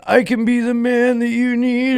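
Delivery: put-on high, pleading promise to girlfriend; mock earnest plea. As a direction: sell yourself to her, high. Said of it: "on the phone with this girl like".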